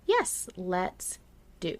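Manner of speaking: normal talking voice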